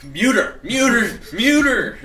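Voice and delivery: laughing shout at chat; yell. Real, not acted. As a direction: bellow the order. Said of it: "shouting at chat to mute".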